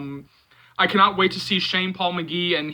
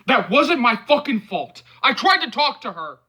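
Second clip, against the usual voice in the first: angry; 7 semitones higher, 5 dB louder than usual. A narrator's reading. Angry defence in fight.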